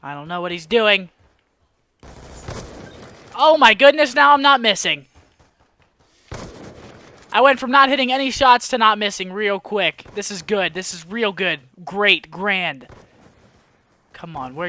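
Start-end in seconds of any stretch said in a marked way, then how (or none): 3.3-8.8 loud, higher burst of amazement; excited disbelief. Real, not acted. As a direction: exclaim it, amazed at your own hits. Suddenly hitting his shots.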